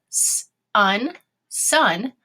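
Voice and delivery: "S-un-" in separate sounds, blended into "sun"; sounded out. Shown as a demonstration. Teacher tapping a first syllable, "S-un-" in pieces. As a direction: tap out "S-un-", then join it. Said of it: "tap out our two syllable word".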